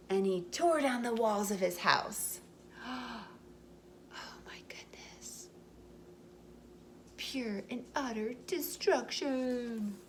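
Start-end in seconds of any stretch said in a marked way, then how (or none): 2.4-5.5 slow, hushed gasp of shock; shocked whisper. Real, not acted. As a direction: breathe it out in amazement. Reader shocked at destruction.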